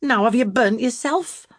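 loud, high, rough scolding; exasperated loud rebuke; rough cry. A narrator's reading. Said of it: "she cried roughly".

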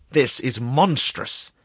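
loud, drawn-out outrage; angry exclamation. A narrator's reading. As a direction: raise voice and pitch in indignation. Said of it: "he exclaimed angrily".

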